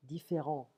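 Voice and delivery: French différent with the final T left silent. Shown as a demonstration. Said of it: "We don't pronounce the T".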